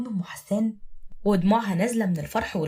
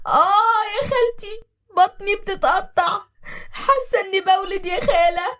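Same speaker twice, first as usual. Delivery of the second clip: very high, loud, drawn-out labour wail; screaming in pain; 12 semitones higher, 6 dB louder than usual. Acted. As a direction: cry out for help as the pains tear through you. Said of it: "صرخت بأعلى صوتها وقالت". (صراخ من ألم الولادة بأعلى صوت)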